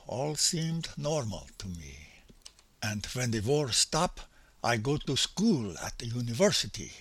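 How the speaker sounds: quiet, lightly imitated German accent; a gentle German accent in broken English. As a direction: keep the soft German accent through the memory. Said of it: "my attempt at his accent".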